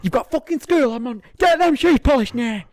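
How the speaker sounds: loud, high mock telling-off from a parent; mock parent scolding. Parent nagging a kid about school shoes, loud mock scold. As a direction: bark it like a nagging parent getting you ready for school.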